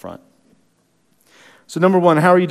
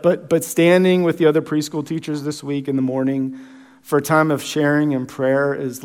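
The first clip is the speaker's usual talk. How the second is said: usual speaking voice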